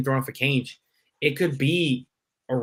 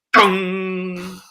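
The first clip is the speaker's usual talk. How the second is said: a hint of a higher, ringing bell; mock bell dong; 4 semitones higher than usual. Kid in the dark hearing the Undertaker's bell, a light mock dong. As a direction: ring out a single church-bell dong.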